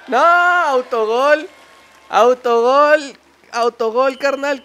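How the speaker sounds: high, loud drawn-out cries; excited shout. Real, not acted. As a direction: yell out the own goal. Own goal yelled. (grito emocionado de autogol)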